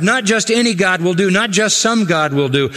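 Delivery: ordinary talk, nothing marked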